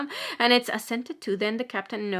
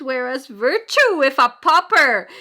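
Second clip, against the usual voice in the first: loud, high, rising outcry; impassioned declamation; 5 semitones higher, 10 dB louder than usual. Acted. Preacher contrasting virtue with sin, loud impassioned cry. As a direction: hurl it out from the pulpit. Preacher's first take, full force.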